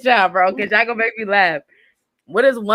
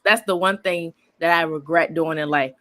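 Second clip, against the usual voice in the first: ordinary talk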